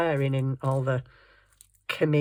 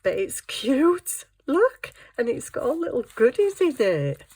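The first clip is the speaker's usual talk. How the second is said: cooing delight; 8 semitones higher, 3 dB louder than usual. Real, not acted. Cooing over fabric book.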